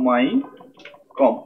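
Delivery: মাইকম stretched very slowly, voice lifted high; drawn out. Real, not acted. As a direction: draw out মাইকম long and high as you type it. Stretches name while typing. (Type করতে করতে মাইকম টেনে টেনে, উঁচু স্বরে)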